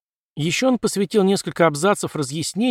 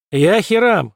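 higher, louder grand announcement; proclamation; 5 semitones higher, 5 dB louder, slower than usual. A narrator's reading. Cult leader to his frightened followers, proclaiming. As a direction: proclaim it boldly, voice raised. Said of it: "объявил Ходжес".

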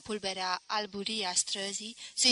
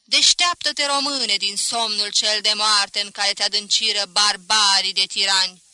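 anthem declaimed loudly; 9 dB louder than usual. A narrator's reading. Students gathered at the statue sounding the anthem, loud chant. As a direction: belt out the verse in one run. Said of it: "și cântară".

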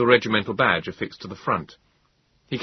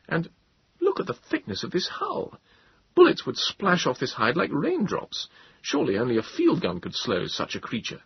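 higher, impressed gentleman; Moses voice; 9 semitones higher than usual. A narrator's reading. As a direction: marvel at the hull.

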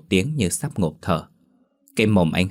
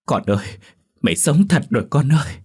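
rising to a higher, louder cry; mother's emotional cry; 7 semitones higher, 4 dB louder than usual. A narrator's reading. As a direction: call to the child, overcome with relief. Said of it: "Bà lồm cồm bò dậy chao đảo mà ú ớ". (mẹ kêu lên xúc động)

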